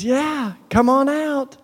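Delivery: high, mock Southern drawl; imitated reply with a Southern twang; Southern accent. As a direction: put on a folksy Southern voice. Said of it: "She didn't have a Southern accent".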